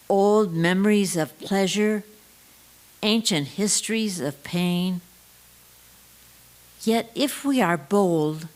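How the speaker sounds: usual speaking voice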